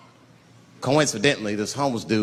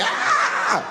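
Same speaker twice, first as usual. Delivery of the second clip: very high thin cry; victim's scream. Acted. Man hit on the forehead, high horrified scream. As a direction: squeal out in horror.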